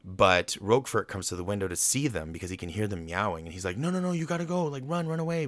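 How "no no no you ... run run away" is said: high-pitched frantic urging; acted-out urgent warning. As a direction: warn them off in a high, panicky rush. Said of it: "trying to warn them about Edgar".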